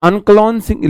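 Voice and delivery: normal talking voice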